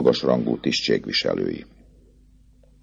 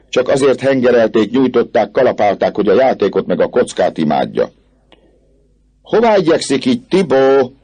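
raised, scornful commentary; mocking remarks; 7 semitones higher, 3 dB louder than usual. A narrator's reading. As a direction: sneer at him from the crowd. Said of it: "csúfondáros megjegyzésekkel és gúnyos".

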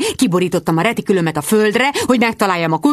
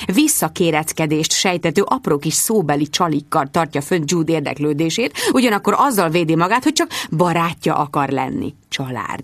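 ordinary delivery, nothing marked

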